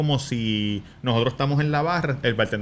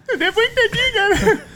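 very high laughing mock sob; fake crying; 3 dB louder, slower than usual. He re-enacts crying to his girlfriend, very high mock sob through laughter. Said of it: "Empecé a llorar".

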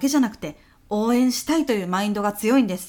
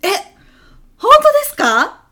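much higher, loud burst of surprise; delighted shock; surprised; 13 semitones higher, 12 dB louder than usual. Acted. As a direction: shoot up in disbelief. Surprised reaction to executive.

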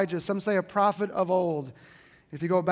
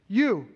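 "You" said louder and pressed hard; emphatic you; 3 semitones higher, 6 dB louder than usual. Shown as a demonstration. Pastor drives home Jesus' emphatic "You". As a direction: land hard on "You" as if pointing. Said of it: "It literally means you".

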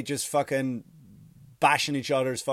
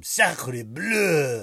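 drawn-out, hammed-up French exclamation; cartoon Frenchman exclaiming, vowels stretched long; exaggerated French accent on a stretched cry; mock French outcry. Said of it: "the French were like".

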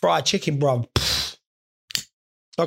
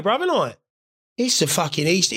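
ordinary talk, nothing marked

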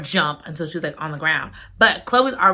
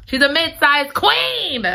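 celebratory gushing; 7 semitones higher than usual. Real, not acted. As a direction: hype her up proudly. Celebrating the character's size.

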